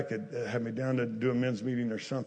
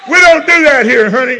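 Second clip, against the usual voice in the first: loud, high, shouted revival preaching; full-force preaching; 11 semitones higher, 17 dB louder than usual. Acted. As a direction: preach it hard to the congregation.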